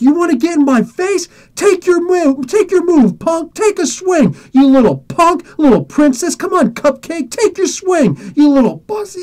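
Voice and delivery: high-pitched, worked-up goading; yelling taunts. Retold or imitated. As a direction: dare him to swing, mocking and heated. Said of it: "I start yelling at the kid".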